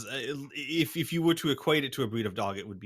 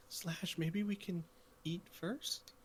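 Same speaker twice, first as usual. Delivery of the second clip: soft, higher pleading to the leader; timid plea; 7 semitones higher, 10 dB quieter, slower than usual. Acted. The small wolf timidly asks Slash if they can eat first. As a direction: ask meekly, hoping to eat first. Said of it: "The small wolf and".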